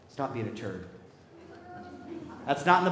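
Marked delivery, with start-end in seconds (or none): 0.1-0.9 gentle father. Acted. Father voiced pleading with son.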